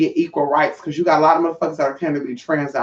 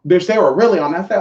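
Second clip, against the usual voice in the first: ordinary talk, nothing marked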